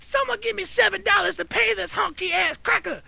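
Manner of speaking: high-pitched imitation of an irate lady; high caricatured ranting voice; mock outraged demand. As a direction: appeal to bystanders, high and indignant. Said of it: "she proceeded to ask somebody".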